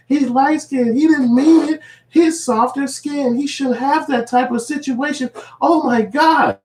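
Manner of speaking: very high, slow, gushing; mock doting voice. Fans excusing him because he's light skin, high mock coo.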